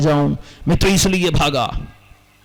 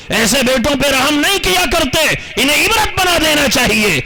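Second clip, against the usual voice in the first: damn the son with rising fury; preacher voicing Malik's furious shout against the cruel son; angry condemnation; 9 semitones higher than usual.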